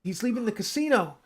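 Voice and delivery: high, pressing warning; mock urgent call; urgent alarm. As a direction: urge Steve to stop him. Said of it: "He said".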